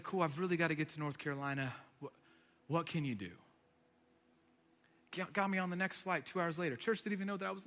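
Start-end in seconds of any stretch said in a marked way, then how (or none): 0.0-3.3 low, soft, resigned calm; composed after breath. Acted. Traveler to airline agent after a deep breath, calm and composed. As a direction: let it go quietly. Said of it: "I took a breath and I just said".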